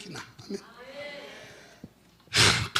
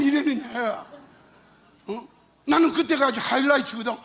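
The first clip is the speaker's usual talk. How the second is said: ordinary delivery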